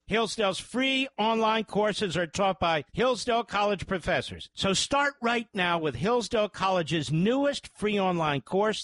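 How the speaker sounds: sponsor ad read. Acted. Host pitching a free college course to radio listeners, ad read. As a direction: sell it with measured, rising emphasis.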